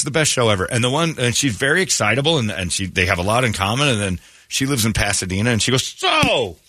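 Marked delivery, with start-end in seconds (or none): 5.8-6.6 high, slow, sing-song imitation of an over-eager question; eager dater imitation. Imitating an excitable dater asking her date where he lives. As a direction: stretch the question out high and bright. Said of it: "'Cause that's how they talk".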